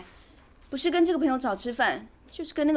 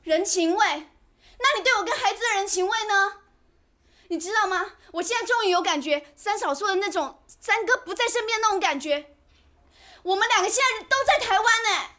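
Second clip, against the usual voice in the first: high, raised voice, aggrieved and angry; loud marital quarrel; 7 semitones higher than usual. Acted. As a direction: throw the accusation back at your husband. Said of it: "你这么大声干什么". (拉高嗓门委屈地争吵)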